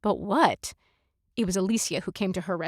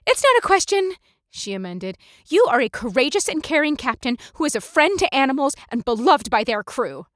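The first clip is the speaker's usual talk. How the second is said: higher, earnest, eager praise; firm amendment; 5 semitones higher, 6 dB louder than usual. Acted. Darmonda corrects herself to the captain, earnest and eager. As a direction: fix the mistake earnestly.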